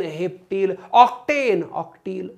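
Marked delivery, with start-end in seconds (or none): 0.9-1.6 octane high, falling at the end; punchy classroom call; called-out drill. Shown as a demonstration. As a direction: call out octane to lead a chant. Chants alkane against alkyl name.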